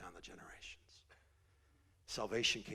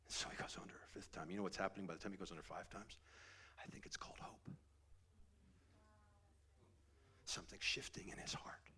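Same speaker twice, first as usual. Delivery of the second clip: whisper; 8 dB quieter than usual. Real, not acted. Hushed preaching climax.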